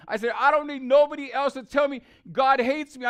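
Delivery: fervent testimony building in protest; impassioned preaching. Real, not acted. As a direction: drive each repetition home harder. Preaches rejection at full force.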